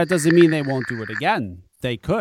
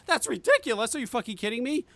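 retold high-pitched, indignant questioning; incredulous echo; 9 semitones higher, 4 dB quieter than usual. Son answering his mother's dismissal, incredulous. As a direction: throw the word back in high disbelief. Throws mother's word back.

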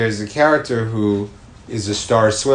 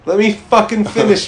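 mock outburst; 10 semitones higher, 5 dB louder than usual. Mock quarrel, repeats demand.

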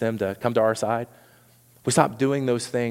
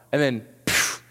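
normal talking voice

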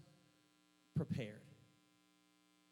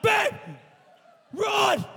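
high, urgent shouted warning; panicked yell; 16 dB louder than usual. Retold or imitated. Preacher reenacts crawling out, warning babe, panicked yell. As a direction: yell it as you scramble to escape.

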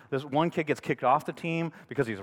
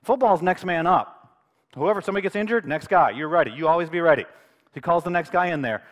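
ordinary delivery

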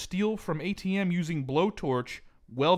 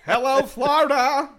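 loud greeting; 10 semitones higher, 8 dB louder than usual. Real, not acted. Greeting Florida grandly.

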